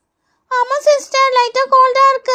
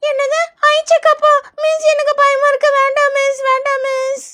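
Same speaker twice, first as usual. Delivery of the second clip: put-on high scared-child whine; alarmed pleading; 6 semitones higher than usual. Child learns of an eye check-up and begs teacher, alarmed pleading. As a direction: beg off in a little kid's voice.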